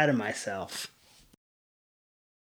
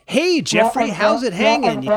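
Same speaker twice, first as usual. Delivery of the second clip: higher, louder mock-casual therapist greeting; Palo Alto therapist; 6 semitones higher, 4 dB louder than usual. Therapist starting a session with a teen, put-on chummy. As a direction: put on a breezy therapist hello. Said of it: "like the Palo Alto therapist".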